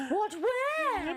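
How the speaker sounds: high gasping mock alarm; imitating Mum. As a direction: gasp and squeak where. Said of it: "What was it Mum said?".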